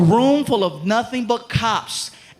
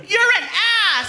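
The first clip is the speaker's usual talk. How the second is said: high, loud scolding woman; angry girlfriend; 11 semitones higher, 4 dB louder than usual. Retold or imitated. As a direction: snap at him like the annoyed girlfriend.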